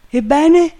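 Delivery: very high, loud question; overjoyed shout. A narrator's reading. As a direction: burst out with joyful anticipation. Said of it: "gridò Legrand al colmo della gioia".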